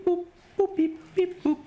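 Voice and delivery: high squeaky beep boop noises; beeping ringtone imitation. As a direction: chirp it like a tinny midi ringtone. Imitating cheap midi ringtones.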